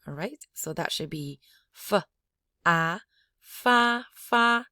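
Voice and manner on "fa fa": "fa" loud, high and level; high bell tone. Shown as a demonstration. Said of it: "high bell tone".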